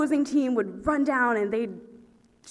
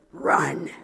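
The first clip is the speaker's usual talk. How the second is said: mock menacing whisper; 9 semitones lower than usual. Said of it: "he was just like".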